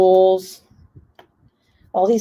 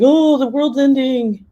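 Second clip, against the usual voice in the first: put-on dramatic kid voice; 6 semitones higher than usual. Son's dramatic complaint.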